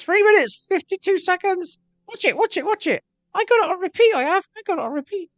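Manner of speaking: squeaky, very high mocking voice; excited falsetto mimicry. Same put-on voice, showing views.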